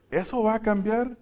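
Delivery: ordinary talk, nothing marked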